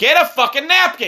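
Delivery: high, loud scolding; dad yelling at son picking his nose; exasperated yell. Real, not acted.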